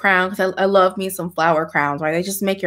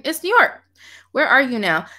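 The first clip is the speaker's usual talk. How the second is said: usual speaking voice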